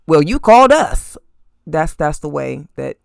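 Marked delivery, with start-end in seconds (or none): 0.1-1.1 a mocking snide cop voice, louder and higher; nasty tone. Cop to Sonya at the door, nasty. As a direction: sneer it like a rude cop. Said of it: "very nasty tone".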